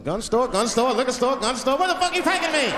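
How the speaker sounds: acted passenger panic, climbing in pitch; mounting alarm. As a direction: count off sights with growing alarm. Passenger alarmed by passing gun stores.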